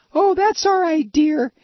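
breezy, self-sacrificing wife voice; high wife voice. Pretend. Acted skit of the wife.

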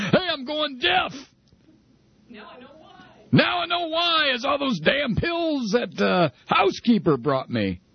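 mock panic, yelling the realization; panicked outcry. Playing Rush, panicked outcry.

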